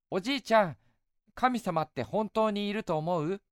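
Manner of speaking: put-on high, eager young boy. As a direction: play a cheerful little boy. Boy asks grandfather.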